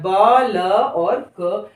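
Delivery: "ब, ल, क" said very slowly with pauses; slow spelling out. Shown as a demonstration. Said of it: "वहीं से शुरू करना है". (अक्षरों को धीरे-धीरे गिनकर बोलना)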